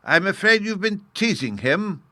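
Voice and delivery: lightly voiced girl, setting him straight; Sylvie, teasing. A narrator's reading. Sylvie tells the dreamer he's lying flat, teasing.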